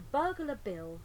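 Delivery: ordinary delivery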